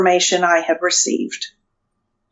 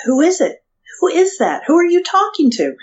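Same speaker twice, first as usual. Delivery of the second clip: higher, insistent questioning; retold husband asking who is on the line, higher; husband's questions; 8 semitones higher than usual. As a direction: pester her like the curious husband. Husband pestering during phone call.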